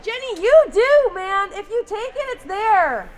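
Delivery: high, loud urging from the stands; shouting encouragement. Real, not acted. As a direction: yell at her to shoot. Yelling advice at a game replay.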